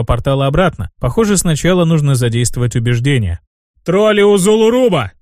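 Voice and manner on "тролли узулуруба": raised-pitch rallying call; narrated demon's proclamation, not truly shouted; booming proclamation. A narrator's reading. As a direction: address a whole tribe, voice lifted high. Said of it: "Рокотом пронёсся по джунглям мой голос".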